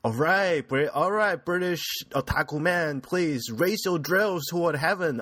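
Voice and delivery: high, loud rallying shout; rally the fans like a mecha hero; hyped battle cry. Acted. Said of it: "Okay, that was kinda lame".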